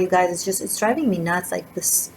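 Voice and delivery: ordinary delivery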